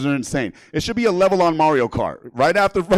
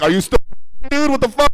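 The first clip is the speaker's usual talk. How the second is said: raised-voice, incredulous outburst; exasperated Netflix; 9 semitones higher, 3 dB louder than usual. Acted. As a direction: Netflix losing patience with the viewer.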